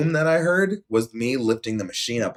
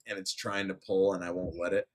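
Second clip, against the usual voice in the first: usual speaking voice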